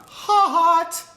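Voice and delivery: ordinary delivery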